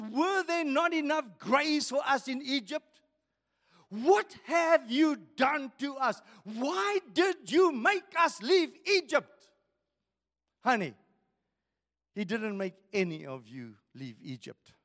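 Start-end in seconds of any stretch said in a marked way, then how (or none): 0.0-9.5 a mild mock outcry at Moses; angry crowd. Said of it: "imagine this crowd raising their voices".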